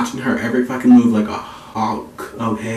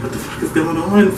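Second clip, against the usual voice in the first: higher, startled mom bursting in; mom's alarmed voice; 5 semitones higher than usual. Retold or imitated. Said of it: "she's like".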